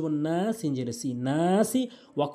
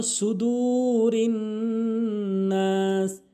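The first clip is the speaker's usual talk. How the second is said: slow, high, chanted tilawat; Quran recitation; 5 semitones higher, much slower than usual. Shown as a demonstration. Teacher models the verse in chanted recitation. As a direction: chant the verse with long held vowels.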